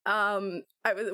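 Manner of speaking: normal talking voice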